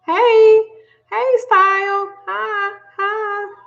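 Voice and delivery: high-pitched drawn-out hello; singsong greeting. Real, not acted. Host greets arriving viewers, high and drawn-out.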